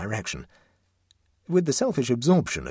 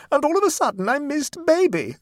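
very high, loud, shrill wail; shrill panic, pitched right up; shriller tone; 7 dB louder than usual. A narrator's reading. Mother presses on over an interruption, shrilly. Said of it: "in a shriller tone".

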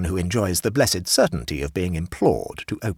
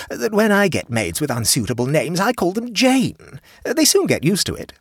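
a hint of a fussy higher hostess; lightly voiced hostess; 13 semitones higher, 5 dB louder than usual. A narrator's reading. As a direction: raise the pitch for the chatty hostess.

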